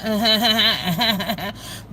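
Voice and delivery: real amused giggling laugh. Real, not acted. Streamer tickled by the boy on the boat, laughing. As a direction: run of voiced chuckles.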